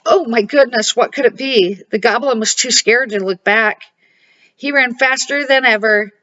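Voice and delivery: ordinary delivery, nothing marked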